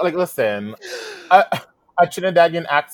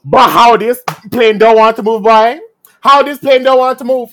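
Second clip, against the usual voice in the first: loud, higher-pitched Trini outburst; indignant Trini accent; 6 semitones higher, 9 dB louder than usual. Retold or imitated. Retelling Trini passengers' complaint about the stuck plane, indignant Trini accent. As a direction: imitate a fed-up Trini passenger, loud and high. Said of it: "every fucking Trini".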